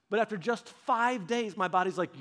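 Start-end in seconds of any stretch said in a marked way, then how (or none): none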